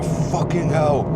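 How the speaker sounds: put-on slow, high-pitched exasperated curse in an Eastern European accent; imitating the thief's frustration as the bag rips; mock Eastern European curse. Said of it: "like, Eastern European or something".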